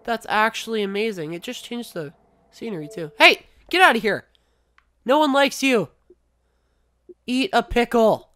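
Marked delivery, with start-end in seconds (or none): 3.2-8.3 loud, high shout; mock-angry yelling. Real, not acted. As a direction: shout it off like an intruder. Yelling at the listening Xbox.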